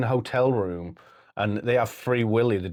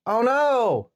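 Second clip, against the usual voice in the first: much higher, loud hammed-up dismay; a cartoonish cry of dismay; mock horror; 12 semitones higher, 3 dB louder than usual. Guy reacts in horror.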